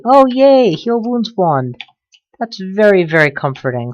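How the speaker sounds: high mock cheer, sarcastic. Real, not acted. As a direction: fake delight, dripping sarcasm. Sarcastic about bad loot.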